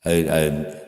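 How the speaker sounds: usual speaking voice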